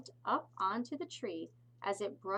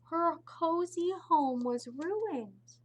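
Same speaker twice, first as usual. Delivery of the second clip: dismayed; 9 semitones higher, 7 dB louder, slower than usual. A narrator's reading. Sad story climax.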